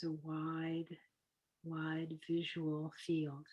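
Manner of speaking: softly spoken breathing-exercise guide; guided meditation. Acted. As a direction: lead them gently into the breathing, unhurried.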